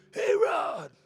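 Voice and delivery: high, loud call across a room. Retold or imitated. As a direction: shout his name across the store. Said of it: "I hear Bruce go".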